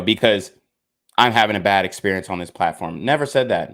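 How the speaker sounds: usual speaking voice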